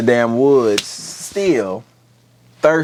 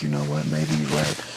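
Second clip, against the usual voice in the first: smug prince; 7 dB quieter than usual. Retold or imitated. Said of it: "it's like".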